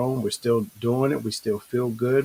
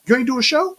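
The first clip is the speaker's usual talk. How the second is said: scolding friend; 13 semitones higher, 3 dB louder, faster than usual. Retold or imitated. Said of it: "Bobby will, he'll chew my head off".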